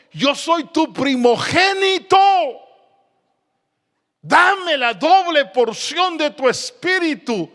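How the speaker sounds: high, slow impassioned shout; grito de súplica. Acted. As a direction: cry it out as a disciple claiming his inheritance. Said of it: "¿Sabe qué estaba diciendo Eliseo?". (clamor agudo y prolongado)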